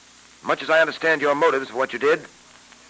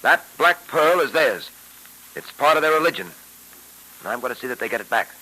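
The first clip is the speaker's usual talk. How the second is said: put-on old radio show. Old-time radio drama episode.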